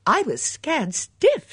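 slow, high, loud and dramatic; gleefully overstated fright; emphatic exclamation. A narrator's reading. Bunch tells of her fright.

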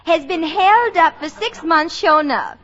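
mock Southern belle reading back a letter; a comic Southern belle voice; put-on Southern accent. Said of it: "a girl with a real Southern accent".

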